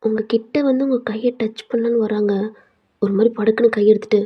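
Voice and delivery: plain reading voice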